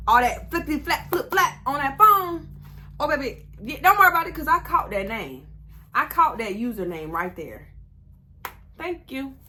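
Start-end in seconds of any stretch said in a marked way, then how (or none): 0.1-4.3 gleeful. Real, not acted. Gleeful about catching username.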